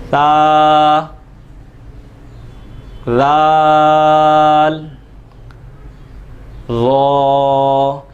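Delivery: letters ता, लाल, ज़ा stretched long, tongue tip on the upper teeth, no whistle; slow drawn-out letters. Shown as a demonstration. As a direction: hold each letter ता, लाल, ज़ा slow and without hissing. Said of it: "देख लीजिए".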